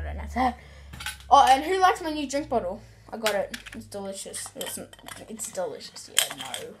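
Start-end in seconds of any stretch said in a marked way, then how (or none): none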